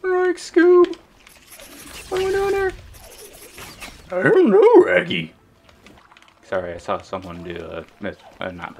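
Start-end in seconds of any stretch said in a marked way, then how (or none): 0.1-5.3 high, loud cartoon voices; high loud cartoon banter; Scooby-Doo and Shaggy bit. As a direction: squeak both lines like cartoon dog and pal. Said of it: "Scooby-Doo impression".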